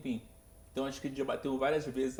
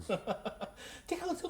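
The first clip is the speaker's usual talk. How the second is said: high, slow mock panic; drawn-out, high-pitched retold question; alarmed questioning; 14 semitones higher, slower than usual. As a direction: imitate a worried parent, pitched way up. Re-enacts the questioning of the child.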